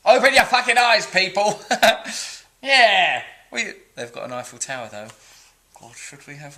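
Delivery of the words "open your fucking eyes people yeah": louder, higher exasperated outburst; mock rant. Channelling an angry commenter, hammed-up furious shout. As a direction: bellow it like a fed-up ranter. Ranting reading of viewer comment.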